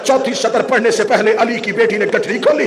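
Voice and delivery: ordinary delivery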